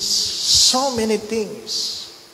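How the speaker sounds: so many things stretched out slow and high; a pleading, dragged-out echo; slow emphatic repeat. Acted. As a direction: lean on "so many things", drawing each word out. Preacher repeats phrase for emphasis.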